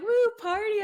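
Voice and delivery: mock high woo; imitating a tipsy partygoer cheering; high, gleeful party whoop; drunk party cheer.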